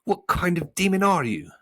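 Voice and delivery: high, loud challenging question; indignant. A narrator's reading. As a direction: demand an answer indignantly. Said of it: "said Milo indignantly".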